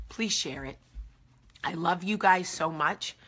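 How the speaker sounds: normal talking voice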